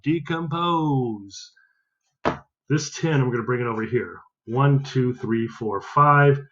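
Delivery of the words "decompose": Decompose stretched slowly and clearly; slow model word. Shown as a demonstration. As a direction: draw out Decompose for the class to echo. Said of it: "Say that word out loud".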